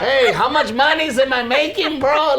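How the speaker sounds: high, loud, laughing mock demand; Pollito. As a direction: play a brash bro shouting for his cut. Acting out Pollito joking at show.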